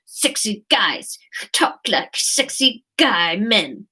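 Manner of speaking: slow, whispered mock seduction; hammed-up breathy, drawn-out flirting; sexy guy voice. Shown as a demonstration. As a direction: whisper it like a parody heartthrob. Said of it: "Like that".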